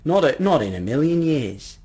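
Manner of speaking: put-on emphatic old gentleman refusing; protesting old man. Old man pressing his umbrella on the lady, protesting. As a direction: protest with courteous insistence.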